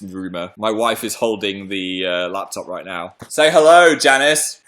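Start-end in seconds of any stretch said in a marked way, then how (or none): none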